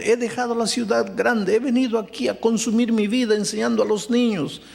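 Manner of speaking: high, pleading and anguished; a broken young teacher demanding why from the pastor; grieving young woman. Retold or imitated. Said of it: "me dice, Pastor". (joven afligida, voz alta y suplicante)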